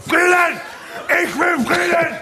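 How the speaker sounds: mock rally shout. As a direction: shout like a protester. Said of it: "Machst du mich nach?". (gespielter Friedensruf)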